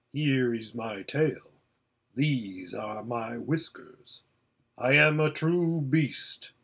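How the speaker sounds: slow, measured great lion; Aslan, solemn and commanding. Acted. Lion bids frightened horse draw near, solemn and commanding. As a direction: command slowly, leaving space between phrases.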